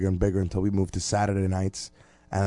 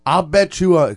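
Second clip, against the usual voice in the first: mock Southern drawl, pitched high and loud; 8 semitones higher, 7 dB louder than usual. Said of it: "'cause he's got a drawl".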